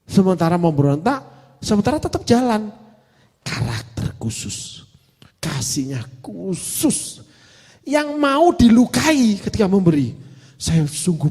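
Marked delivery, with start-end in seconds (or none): 3.4-7.3 slow breathy whisper. Real, not acted. Preacher whispers to the congregation about special love. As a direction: drop to a slow whisper to make it intimate. Reflective sermon aside.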